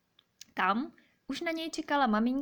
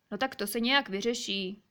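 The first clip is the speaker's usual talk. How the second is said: usual speaking voice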